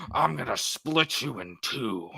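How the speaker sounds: slow, dark threat in a put-on voice; a slow mock villain threat; menacing killer. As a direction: drag it out to scare her. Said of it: "he goes".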